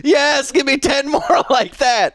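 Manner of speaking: high, laughing, thrilled approval; delighted excitement. Real, not acted. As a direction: cheer them on through laughter. Delighted by the pun, wants more.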